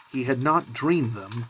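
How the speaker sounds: plain reading voice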